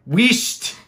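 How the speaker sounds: high, loud mock command; playful Scots shushing. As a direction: snap it out as a mock order to shut up. Acts out shushing command after gloss.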